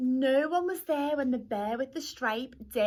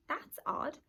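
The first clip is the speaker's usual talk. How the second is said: muttering; 6 semitones higher than usual. Acted. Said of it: "then muttered".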